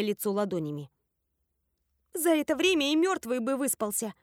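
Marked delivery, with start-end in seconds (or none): 2.1-4.1 high, unsparing retort; merciless exclamation. A narrator's reading. Nani refusing to let a friend sleep, merciless. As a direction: exclaim it without mercy. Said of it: "безжалостно воскликнула Нани".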